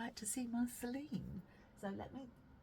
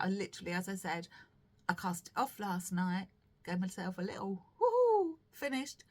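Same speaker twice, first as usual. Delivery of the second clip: ordinary talk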